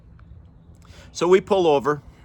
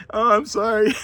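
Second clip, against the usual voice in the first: high, louder, still cracking up; helpless with laughter; laughing apology; 10 semitones higher, 5 dB louder than usual. Real, not acted. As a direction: apologise through real laughter.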